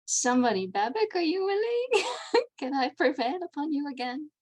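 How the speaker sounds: ordinary talk, nothing marked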